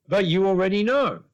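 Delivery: high, loud, emphatic retort; acted insistence. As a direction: throw it back as if it's obvious. Retold caller challenges the speaker.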